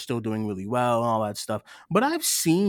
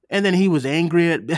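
ordinary delivery

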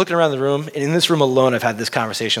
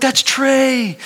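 high, giddy fan outburst, acted out; starstruck excitement; 13 semitones higher than usual. Fan telling his wife who he spotted, starstruck excitement. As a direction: point out your idol in a high excited voice.

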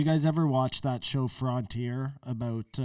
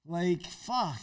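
ordinary delivery

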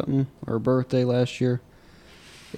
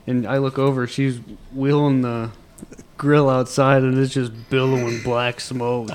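ordinary talk, nothing marked

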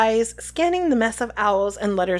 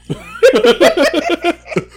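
high, loud burst of laughter; sudden loud giggling; laughing; 7 semitones higher, 8 dB louder than usual. Real, not acted. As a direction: crack up laughing. Laughs at the story.

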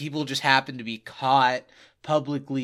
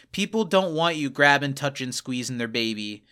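ordinary talk, nothing marked

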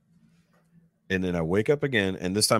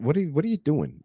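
imitated wife; 8 semitones higher than usual. Voices his woken wife asking again.

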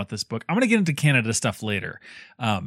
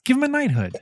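mock plea; 10 semitones higher, faster than usual. Mock plea to British royal family.

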